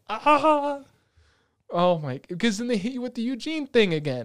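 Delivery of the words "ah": high, loud "ah"; mock emotional cry. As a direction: cry out as if moved, in imitation. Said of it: "she forgives Shin and is like".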